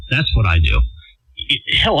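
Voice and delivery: ordinary delivery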